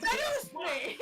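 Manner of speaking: Spy voice. Retold or imitated. Quoting Spy in Team Fortress.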